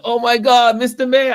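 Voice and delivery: high, loud mock cry of amazement; hammed-up, high-pitched astonishment; retold shock. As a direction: imitate a shocked, thrilled man crying out. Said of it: "He was so shocked".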